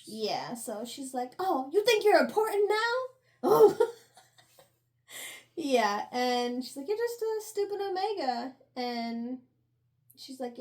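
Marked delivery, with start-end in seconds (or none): 1.4-3.9 mocking bully. Bully taunting the girl. 6.9-8.5 sneering bully. Retold or imitated. Bully insulting the girl.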